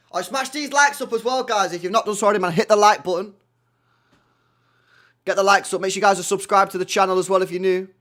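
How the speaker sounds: loud, pumped channel plug; hype plug. Real, not acted. Streamer urging viewers to like and subscribe, loud hype. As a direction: hype them to hit like. Asking for likes.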